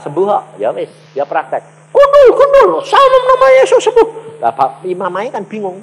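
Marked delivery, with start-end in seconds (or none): 1.9-4.4 very high, loud chanted yell; frantic-sounding acted shout. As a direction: scream out the healing command. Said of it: "Ini sing teriak-teriak".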